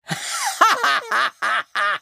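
high, theatrical cackle; villain cackle. Acted. Sea witch pleased the child is finally here, high cackle. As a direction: cackle high in bursts, falling away. Villainess laughs after summoning.

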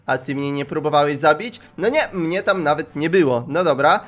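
ordinary delivery

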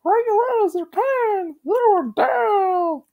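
very high, loud and slow puppet chant; high slow taunting puppet voice; Elmo's voice. Acted. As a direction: drag it out in a creepy high puppet singsong.

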